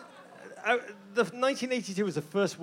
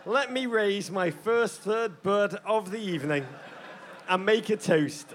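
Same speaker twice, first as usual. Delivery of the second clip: ordinary delivery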